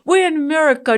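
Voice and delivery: loud, high mock protest with we're stressed; imitating the boys repeating it; kids' loud complaint. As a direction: insist we're in America like a fed-up kid. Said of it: "my boys will always say".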